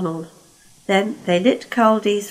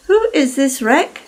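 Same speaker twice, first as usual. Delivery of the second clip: higher, teasing; mock-innocent Caldy; 6 semitones higher, 4 dB louder than usual. Caldy teases stuck Lord Harry, higher and loud. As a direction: feign not knowing him.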